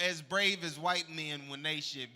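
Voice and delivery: usual speaking voice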